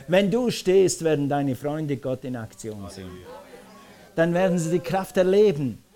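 normal talking voice

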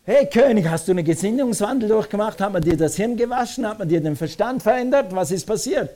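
high, excited volley of questions; incredulous questioning. Acted. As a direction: badger the king in mock amazement. Acts someone questioning the changed king.

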